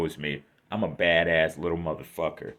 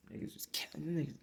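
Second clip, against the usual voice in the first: whispered aside; 7 semitones higher, 13 dB quieter, faster than usual. Real, not acted.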